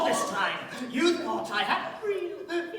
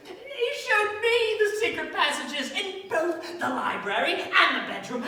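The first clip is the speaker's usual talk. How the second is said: normal talking voice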